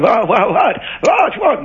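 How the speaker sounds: high, rising confused what; jolted out of sleep, baffled; mock startled waking up; startled awake. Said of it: "it would sound something like".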